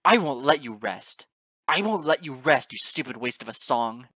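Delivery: loud, impassioned, raging vow; spat-out fury. A narrator's reading. Narrator vowing to haunt the goddess forever, spitting with fury. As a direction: shout it desperately, spitting each phrase. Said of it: "I clutched the Nightbringer to the point of breaking its bat".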